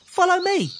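very high, bright command; cheerful Mister Happy. Acted. Mister Happy tells Mister Miserable to follow, bright and high. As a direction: lead the way brightly.

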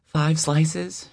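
put-on high, eager child's question; excited little girl. Five-year-old asking the deli lady about pickles, high and eager. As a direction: bounce with excitement over pickles. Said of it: "she asks excitedly".

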